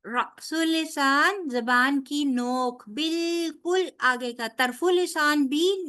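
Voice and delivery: usual speaking voice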